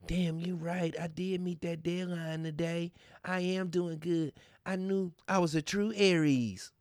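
mock believer. Mocking a gullible Aries believer.

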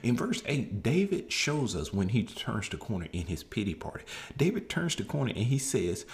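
ordinary talk

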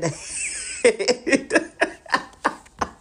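long, loud giggling burst; helpless run of laughter; real laughing fit. Real, not acted. Laughing at own mock smack scenario.

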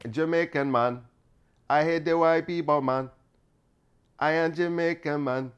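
mock Jamaican lilt; Jamaican accent. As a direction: try out a put-on Jamaican accent. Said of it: "what would a Jamaican sound like?".

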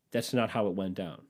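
ordinary delivery

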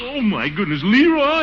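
high startled shout. Acted. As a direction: yell at the noisy kid. Gunfire wakes him up.

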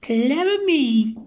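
high-pitched, squeaky-small character voice; self-pleased little dinosaur. A narrator's reading. Little Comp praising herself over a stolen egg, high self-congratulation. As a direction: pipe it up high, pleased with yourself. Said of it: "and murmured".

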